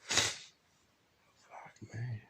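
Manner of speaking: breathy whispered curse under breath; whispering an exasperated curse on a plane. Real, not acted. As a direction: mutter it to yourself unvoiced. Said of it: "I don't know why I'm doing this".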